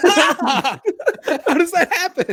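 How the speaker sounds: high laughing disbelief, slow; can barely get the words out for laughing. Real, not acted. Guest cracking up at the silly stick wound, laughing. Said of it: "laughing while asking".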